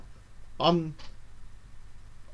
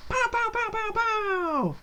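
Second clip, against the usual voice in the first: very high falsetto "pow" noises; string of high pows, falling on the last; celebratory pow noises; slower than usual. Pretend. Player celebrating a winning roll, playful high pow noises.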